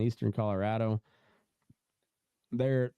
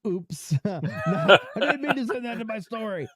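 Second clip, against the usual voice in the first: high-pitched oops through laughter; laughing mock excuse; 12 semitones higher, 6 dB louder than usual. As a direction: play the sheepish leaker, cracking up. Plays the leaker, laughing at the mishap.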